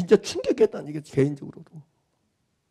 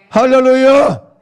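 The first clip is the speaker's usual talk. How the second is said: loud hallelujah call; exultant preacher's call; hallelujah shout; 2 semitones higher, 8 dB louder, slower than usual. Real, not acted. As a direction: cry out hallelujah to the room.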